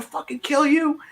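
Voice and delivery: a teasing dad delivering bad news; warning dad. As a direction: threaten him with mom. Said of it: "he's like".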